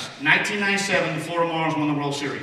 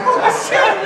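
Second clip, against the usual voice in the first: shocked reaction; 10 dB louder than usual. Retold or imitated. Players react to manager falling.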